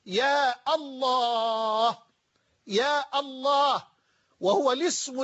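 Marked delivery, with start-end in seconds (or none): 0.1-2.0 يا الله as a long "yaa Allaah"; article kept after ya. Shown as a demonstration. Teacher shows the vocative يا الله, drawn out with article kept. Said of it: "فأنت تقول مثلًا". 2.7-3.9 يا الله slowly, "yaa Allaah" with the Al sounded; article kept after ya. Shown as a demonstration. Teacher repeats the vocative يا الله, slow with article kept. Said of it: "لا نحذف الألف واللام".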